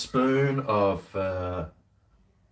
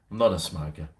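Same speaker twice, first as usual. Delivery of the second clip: usual speaking voice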